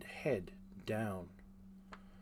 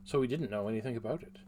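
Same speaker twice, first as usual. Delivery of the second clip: usual speaking voice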